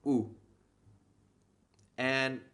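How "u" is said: short Thai "u."; vowel sound. Shown as a demonstration. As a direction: say "u." briefly. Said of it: "this vowel makes the sound".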